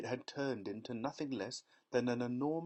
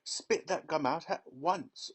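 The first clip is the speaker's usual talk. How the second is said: slow, louder stern command; firm order; 6 semitones higher, 5 dB louder than usual. A narrator's reading. Father ordering daughter, slow stern command. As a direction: lay down the law. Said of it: "ordered Mister Beauregard".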